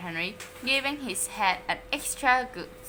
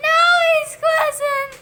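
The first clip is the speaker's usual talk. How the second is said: much higher, loud wailing sob; sobbing; 12 dB louder than usual. A narrator's reading. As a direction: cry it out through tears. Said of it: "he sobbed".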